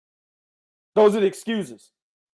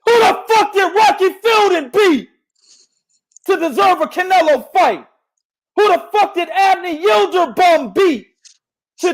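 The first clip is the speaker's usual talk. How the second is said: angry rant; 8 semitones higher than usual. Real, not acted. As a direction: bark the question back at them. Ranting at critics' question.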